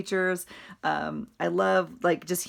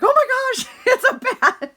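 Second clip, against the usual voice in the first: shocked cry; 9 dB louder than usual. Retold or imitated. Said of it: "My husband's like".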